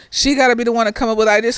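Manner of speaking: normal talking voice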